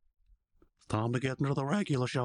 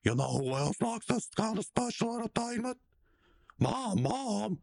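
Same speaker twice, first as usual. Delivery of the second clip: mock Muscle Man; 10 semitones higher than usual. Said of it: "Muscle Man says".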